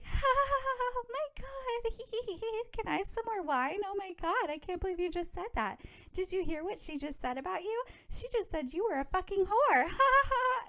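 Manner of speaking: put-on high, giggly party-girl voice with laughs; giggly and laughing. Jacqueline giggling at gossip over wine: high laughing voice. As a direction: laugh through every line, sky high. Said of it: "she's laughy".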